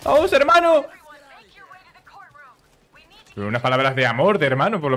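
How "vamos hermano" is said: very high, loud rallying cry; excited shout. Real, not acted. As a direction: cheer him on at full voice. Cheering the rescued ally.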